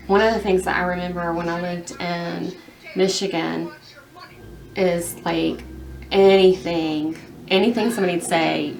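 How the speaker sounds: ordinary talk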